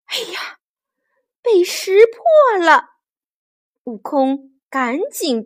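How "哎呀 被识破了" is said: high-pitched dismay at being caught; alarmed surprise. Acted. Monkey hero realising the disguise is seen through, alarmed.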